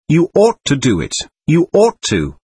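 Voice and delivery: insistent, with "ought" leaping out of each phrase; immense stress on OUGHT. A narrator's reading. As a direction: lean hard on the word "ought". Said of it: "laying immense stress on the word ought".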